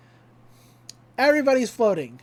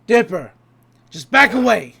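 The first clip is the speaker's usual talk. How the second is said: firm warning; 8 dB louder than usual. Retold or imitated. Stan warning Dipper.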